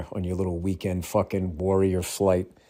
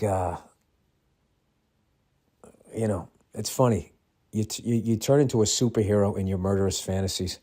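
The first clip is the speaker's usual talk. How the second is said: usual speaking voice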